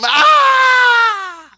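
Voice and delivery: long, high, loud mock shriek; imitated fan scream. Cousin seeing The Rock choke slammed, screaming. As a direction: scream in horror like a superfan. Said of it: "And she started screaming again".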